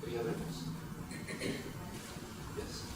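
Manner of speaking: usual speaking voice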